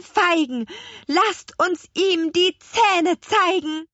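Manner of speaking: high, rhythmic witch's spell; incantation. A narrator's reading. Little witch rouses the garden fruit, chanting a spell. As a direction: chant the rhyme, rising in pitch towards the end.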